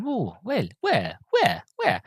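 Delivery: small, soft high voice; innocent pondering. Retold or imitated. Passenger innocently wondering where, soft high put-on voice. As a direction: mimic a naive man wondering to himself. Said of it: "He's very innocent".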